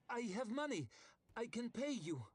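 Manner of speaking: high, anxious plea; frightened pleading lady. A narrator's reading. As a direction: beg in a high, nervous lady's voice. Ida pleading.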